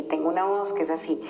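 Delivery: scared, breathless whisper; fearful whisper. Shown as a demonstration. Showing the group how a fearful inner voice sounds, in a whisper. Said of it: "que realmente es súper miedosa".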